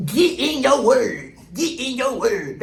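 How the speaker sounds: slow, drawn-out imitation of church folks; mock church talk. Repeating the commenter's phrase in slow mock preacher tone.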